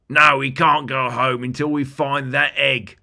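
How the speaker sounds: the bigger goblin putting his foot down. Acted. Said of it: "replied the larger of the group".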